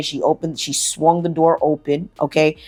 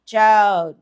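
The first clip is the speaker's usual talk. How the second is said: ordinary talk, nothing marked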